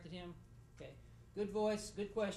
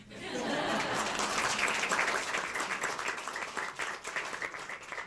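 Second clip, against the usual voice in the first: long, loud, breathy burst of laughter; real laugh at his own joke; big laugh; 8 semitones higher, 10 dB louder than usual. Real, not acted. Judge laughing loudly at his Academy Award quip.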